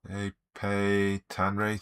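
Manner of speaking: ordinary delivery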